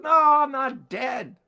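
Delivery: high, loud and slow insistence; shouted denial. Retold or imitated. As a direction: yell it to set them straight. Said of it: "He goes".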